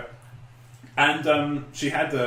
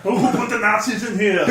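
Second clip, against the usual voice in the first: raised-pitch mock Austrian accent; Arnie; 7 semitones higher than usual. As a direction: do an action-star Austrian impression, pitched up. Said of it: "ends up sounding like fucking Arnie".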